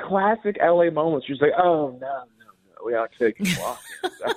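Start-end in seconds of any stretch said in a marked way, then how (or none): 1.6-4.3 mock snooty, dismissive brush-off; dismissive fake hostess voice, half-laughing; snooty hostess imitation. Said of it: "she was so rude".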